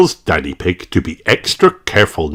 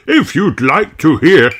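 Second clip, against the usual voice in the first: put-on high, old rabbit voice; lonely old hermit chatting; Grampy Rabbit; 8 semitones higher than usual. A narrator's reading. Grampy Rabbit telling guests he is lonely, old rabbit voice.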